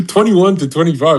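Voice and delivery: real laughter breaking into the words; laughing. Real, not acted. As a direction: crack up while saying it. Laughing at his losing years.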